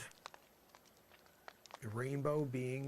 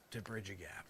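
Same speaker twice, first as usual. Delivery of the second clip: whisper; 6 semitones lower, 8 dB quieter than usual. Acted. Musing to himself, whispered.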